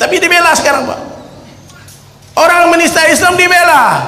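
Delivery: high, loud, slow preaching that rises to shouts; fiery preaching. Acted. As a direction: thunder at the crowd over their silence, each line drawn out. (ceramah berapi-api, tinggi, keras dan lambat)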